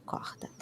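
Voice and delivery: corda whispered with an h R. Shown as a demonstration. Teacher whispers the other regions' corda. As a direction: breathe corda, R as h. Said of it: "they say it like that too".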